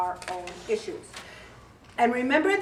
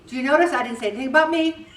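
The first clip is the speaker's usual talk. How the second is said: usual speaking voice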